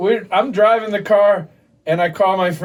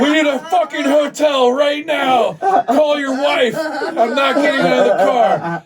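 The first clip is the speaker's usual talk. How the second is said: retold panicked yell; 7 semitones higher, slower than usual. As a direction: shout it slowly like a scared buddy refusing to leave the car. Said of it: "he goes".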